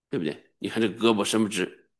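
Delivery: ordinary delivery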